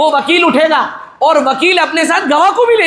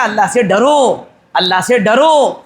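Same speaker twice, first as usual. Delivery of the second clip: solemn warning; 5 semitones lower, slower than usual. Acted. Preacher voicing a solemn warning.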